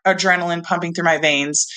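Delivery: ordinary talk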